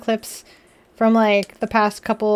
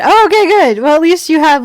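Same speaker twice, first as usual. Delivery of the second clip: excited relief; 5 semitones higher, 10 dB louder than usual. Real, not acted. Relieved loud reaction.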